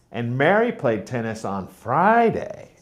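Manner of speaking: Friday lifted and lengthened, rest light; stress on Friday. Shown as a demonstration. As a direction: punch the word Friday. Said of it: "not Saturday".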